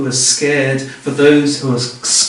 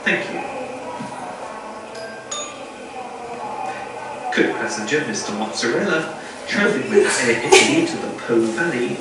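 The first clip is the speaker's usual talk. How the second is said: softer, even tannoy announcer read; airport announcement; 8 dB quieter than usual. Pretend. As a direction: read it like a gate call over the speakers.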